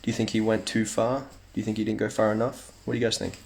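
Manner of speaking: ordinary talk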